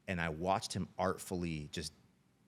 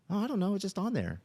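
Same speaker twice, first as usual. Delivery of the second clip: high, louder kid impression; high-pitched boy; 6 dB louder than usual. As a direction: imitate a son's squeaky, defensive voice. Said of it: "he has a little high-pitched voice".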